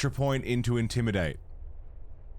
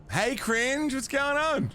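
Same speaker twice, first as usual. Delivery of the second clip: cheery greeting. Real, not acted. Greeting chat user.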